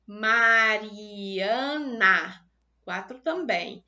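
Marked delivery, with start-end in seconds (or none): 0.1-2.5 "Mariana" said very slowly and louder as Ma-ri-a-na; split into syllables. Shown as a demonstration. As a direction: sound out "Mariana" in four stretched beats. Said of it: "eu pronunciei quatro sílabas".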